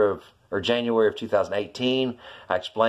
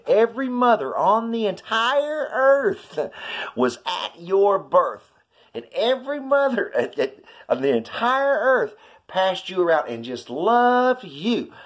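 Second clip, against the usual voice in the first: rising awe; 9 semitones higher, slower than usual. Real, not acted. Awed description of God's love.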